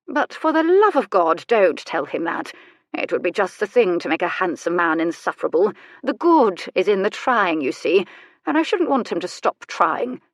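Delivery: acted aristocratic old woman confiding drily; wry old lady. Old lady confides about the vicar.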